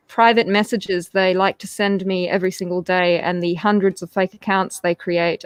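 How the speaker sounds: normal talking voice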